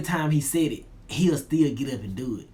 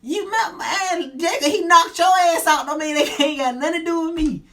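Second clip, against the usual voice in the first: much higher and louder exasperated rant; indignant outburst; 6 dB louder than usual. Retold or imitated. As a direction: blow up in disbelief, half laughing. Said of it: "I'm like".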